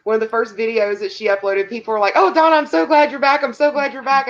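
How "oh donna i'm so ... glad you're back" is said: higher, louder fan gush; imitated excited welcome-back; mock excited greeting. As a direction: gush like a delighted returning viewer, lightly. Acting out viewers' delighted welcome.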